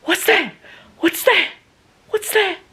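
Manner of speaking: panicked, just jolted awake; startled repeated question. Retold or imitated. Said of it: "I go".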